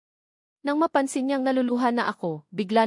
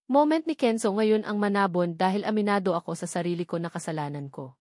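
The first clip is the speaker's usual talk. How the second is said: ordinary delivery, nothing marked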